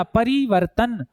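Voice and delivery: परिवर्तन said slowly as परि then वर्तन; teacher sounding out परिवर्तन in two chunks; split into two parts. Shown as a demonstration. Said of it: "इस एक शब्द को दो खंडों में बोला".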